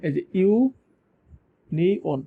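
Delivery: ইউনিয়ন stretched very slowly, holding the opening ইউ; slow drawn-out demo. Shown as a demonstration. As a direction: draw out ইউনিয়ন so the ইউ is heard. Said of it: "এই ইউনিয়নের জায়গায় ইউ".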